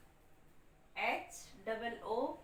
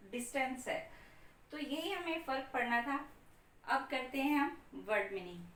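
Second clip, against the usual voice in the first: ordinary talk, nothing marked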